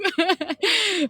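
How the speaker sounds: high, bashful giggle; shy laugh. Real, not acted. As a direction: giggle after the compliment. Thanked, laughs shyly.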